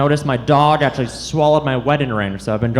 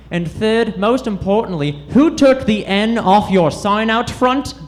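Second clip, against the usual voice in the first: horse at a bar; 6 semitones higher than usual. Pretend. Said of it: "clip clops over to the bartender".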